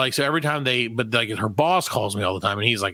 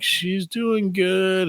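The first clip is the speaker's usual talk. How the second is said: nervous reply; 6 semitones higher, much slower than usual. Retold or imitated. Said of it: "I'm like".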